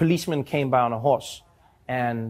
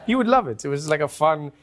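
ordinary talk

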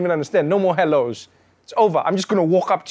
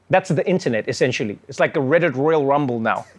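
ordinary talk, nothing marked

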